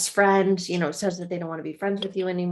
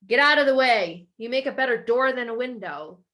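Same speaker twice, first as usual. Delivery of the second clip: louder, gruff brush-off; annoyed dad; 5 semitones higher, 5 dB louder than usual. Retold or imitated. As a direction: bark at the kid blocking the TV. Dad shooing child.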